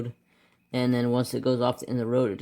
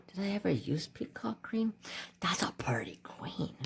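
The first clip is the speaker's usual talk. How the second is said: whispered aside, much softer; 5 semitones higher, 10 dB quieter than usual. Real, not acted. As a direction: murmur it to yourself over the page. Aside to herself while coloring.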